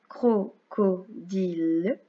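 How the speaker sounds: very slow "crocodile" in three separate pieces; syllable by syllable. Shown as a demonstration. Teacher reading exercise words to tick syllables, slowly syllable by syllable. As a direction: spell out "cro", "co", "dile" with gaps. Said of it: "on coche la syllabe".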